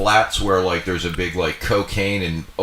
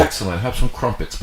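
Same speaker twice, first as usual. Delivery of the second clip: mock British accent. Joking British hospitality.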